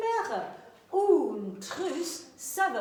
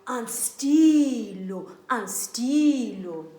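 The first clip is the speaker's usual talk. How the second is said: slow, louder "Un stylo"; slow model word; 3 semitones lower, 6 dB louder, slower than usual. Shown as a demonstration. Teacher modelling the word slowly. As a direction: draw the word out for the class.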